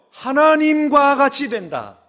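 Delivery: mock serpent tempting loudly; slow emphatic quote. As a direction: dangle the promise slowly and loudly. Serpent's promise quoted in sermon.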